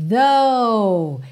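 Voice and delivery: "though" with the tongue touching the front teeth; th sound. Shown as a demonstration. A teacher modelling the TH in "though". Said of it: "A pronúncia é though".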